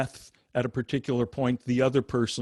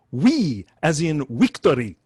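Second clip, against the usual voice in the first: put-on louder Indian-accented "wee, as in wictory"; 3 semitones higher, 8 dB louder than usual. Guru Maharishi answers is that a V or a W, Indian accent. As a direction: imitate an Indian guru turning v into w. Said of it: "it kept sounding like it was a W".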